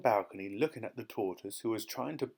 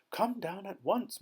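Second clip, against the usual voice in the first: excited call; 9 semitones higher than usual. A narrator's reading. Calling up excitedly.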